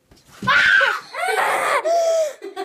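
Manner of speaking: high screaming laugh of fright; shrieking laugh. Real, not acted. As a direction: scream then burst out laughing. Startled by Panda behind door.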